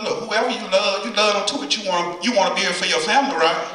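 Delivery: ordinary talk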